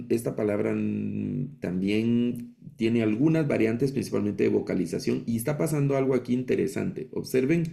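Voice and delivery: normal talking voice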